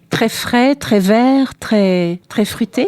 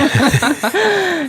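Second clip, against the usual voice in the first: higher-pitched burst of real laughter; amused laugh; 7 semitones higher than usual. Real, not acted. Host reacting to her guest's remark, amused laugh. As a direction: laugh lightly, a short run of ha-has.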